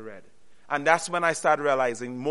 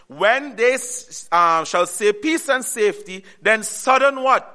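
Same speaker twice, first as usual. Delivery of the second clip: slow, raised verse reading; scripture reading; 3 semitones higher, 4 dB louder than usual. Acted. Preacher reading verse three aloud, slow and raised. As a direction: proclaim the verse slowly.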